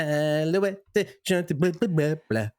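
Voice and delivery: badly faked French, dissolving into gibberish; mock French. Host fumbles the French episode title, mock French. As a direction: stumble through fake French. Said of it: "fluent in French".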